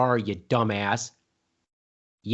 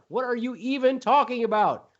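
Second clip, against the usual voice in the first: exasperated outburst; 9 semitones higher than usual. Acted. Mock debate, escalating berating.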